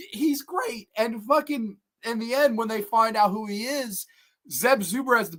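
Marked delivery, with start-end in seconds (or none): none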